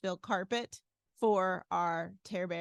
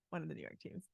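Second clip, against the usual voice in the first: whisper; 4 semitones lower, 12 dB quieter, much faster than usual. Retold or imitated. Confessing the losing opponent.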